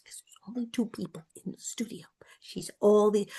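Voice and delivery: soft confiding whisper; whispered behind-the-scenes aside. Real, not acted. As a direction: drop to a whisper for the secret. Aside about studio voices.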